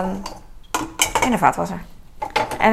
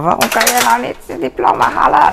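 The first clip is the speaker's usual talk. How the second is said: imitating a fed-up scold, pitched higher; mock exasperated telling-off of her son; frustrated mother; 5 semitones higher, 3 dB louder than usual. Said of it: "dan baal ik zo erg".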